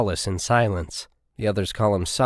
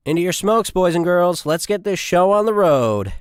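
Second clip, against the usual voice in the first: loud and much higher, calling out to the troops; rallying call; 9 semitones higher, 6 dB louder than usual. Acted. Corporal herds the privates into their carriers, loud rallying call. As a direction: hustle the squad into the vehicles.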